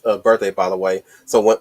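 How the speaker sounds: ordinary talk, nothing marked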